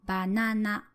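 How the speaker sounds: banana starting low, then staying up with no fall; low-start pitch accent. Shown as a demonstration. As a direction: step up after ba in banana and hold it level to the end. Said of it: "three possible ways to say banana".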